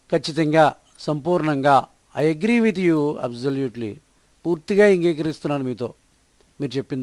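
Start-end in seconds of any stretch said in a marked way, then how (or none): 2.2-3.9 slow, word by word, clearly spaced; slow model sentence. Shown as a demonstration. Teacher to Telugu learners, modelling the English sentence slowly. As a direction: read it slowly for learners to copy. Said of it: "teacher models the sentence slowly".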